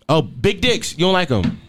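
usual speaking voice

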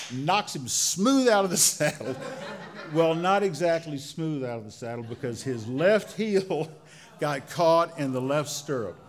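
chuckling through the telling; laughing. Real, not acted. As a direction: laugh breaking into words. Laughing at the uncle's fall.

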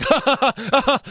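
high-pitched fake ha-ha laugh; mock laughing. Podcaster retells Sheamus starting to laugh, mock laughing. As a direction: imitate a wrestler's forced laugh. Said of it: "starts laughing. He's like".

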